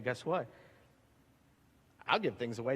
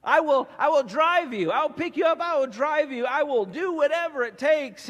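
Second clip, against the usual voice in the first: higher and louder, insistent; a friend promising to drive you, higher and louder; eager promise; 7 semitones higher, 6 dB louder than usual. Acted. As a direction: pledge it like you mean every word.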